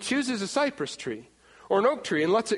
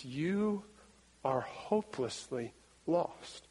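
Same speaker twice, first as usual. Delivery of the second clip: soft, lower, slow grave verdict; solemn hush; 3 semitones lower, 9 dB quieter, slower than usual. Acted. Preacher delivering Isaiah's verdict to the congregation, solemn hush.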